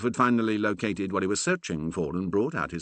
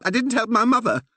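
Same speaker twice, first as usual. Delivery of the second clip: high, loud blurted confession; 6 dB louder than usual. A narrator's reading. Curate blurting his guilt to the Reverend, high and loud. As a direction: blurt it out all at once. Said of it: "Percy finally blurted".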